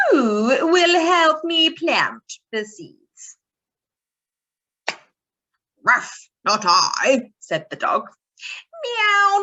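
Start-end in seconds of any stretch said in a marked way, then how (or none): 0.1-3.4 hen's call. Acted. Hen asks in read story. 5.8-7.3 dog voice. Acted. Dog answers in story. 8.8-9.4 meow. Acted. Cat answers in story.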